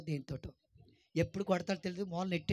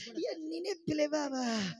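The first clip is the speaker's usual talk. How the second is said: mock groaning old person; 8 semitones higher, slower than usual. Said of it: "అనుకుంటా లెగుతున్నావు".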